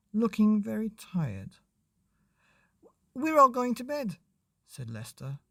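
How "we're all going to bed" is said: put-on high child's voice; high child telling parents. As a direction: chirp it like a young boy. Child voice in story.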